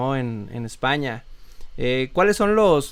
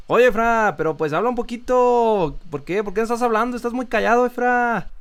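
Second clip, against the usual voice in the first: put-on higher, sing-song northern Mexican accent; 8 semitones higher than usual. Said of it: "hablan como un poquito más cantado". (acento norteño mexicano, cantado y más agudo)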